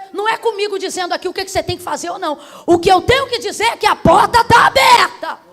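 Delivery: quick build to a high shout; full-force preaching. Real, not acted. Preacher declaring the door is open, full-force preaching. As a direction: proclaim it loudly over the congregation.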